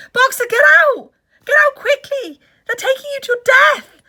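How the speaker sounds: loud high anguished shout; terrible voice. Acted. Clover warning Boxer of death, anguished shout. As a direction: beg him to escape at full voice. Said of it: "in a terrible voice".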